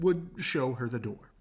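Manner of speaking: usual speaking voice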